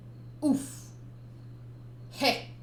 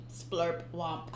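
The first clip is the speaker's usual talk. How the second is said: usual speaking voice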